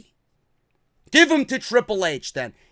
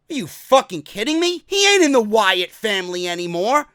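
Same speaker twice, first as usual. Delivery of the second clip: ordinary talk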